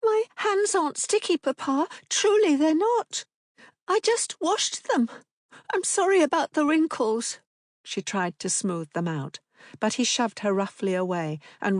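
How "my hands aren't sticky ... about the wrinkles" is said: put-on high, earnest child voice; a small girl anxious to please; pleading little girl. A narrator's reading. Daughter pleading with Papa, high and anxious.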